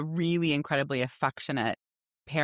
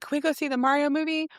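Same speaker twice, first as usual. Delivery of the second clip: put-on high, quick little boy plea; child's voice; 11 semitones higher, faster than usual. Mother imitating her son begging for a movie. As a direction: pitch up like an excited small kid. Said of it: "he was like".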